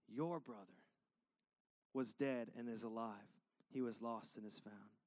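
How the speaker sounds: father calming his resentful son, low and tender. Acted. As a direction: speak to the hurt son like a loving dad. Father answers angry son tenderly.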